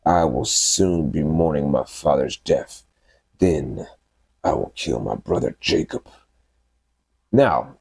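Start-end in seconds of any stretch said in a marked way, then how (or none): 0.0-6.1 scheming. Retold or imitated. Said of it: "Esau began to scheme".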